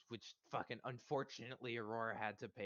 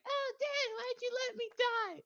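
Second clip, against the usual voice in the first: put-on very high, loud little-girl ghost voice; ghost daughter; 8 dB louder than usual. Ghost daughter reproaching her dad for her death, very high and loud. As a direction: wail it up high like a dead child accusing her father. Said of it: "sent a little ghost daughter in and was like".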